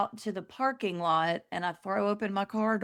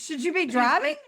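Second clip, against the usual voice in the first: worried mother; 6 semitones higher, 3 dB louder than usual. Retold or imitated. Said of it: "She goes".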